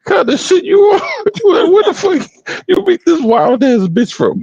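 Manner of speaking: very high, laughing disbelief; retold shock. Retelling his questions to Bone, high-pitched incredulous squeal.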